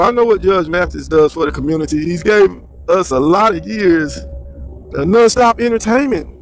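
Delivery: usual speaking voice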